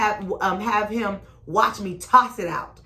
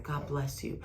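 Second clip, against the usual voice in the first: nice blessing; 3 semitones lower, 9 dB quieter than usual. Shown as a demonstration. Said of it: "You gotta do that nice God bless".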